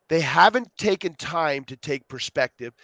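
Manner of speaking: ordinary delivery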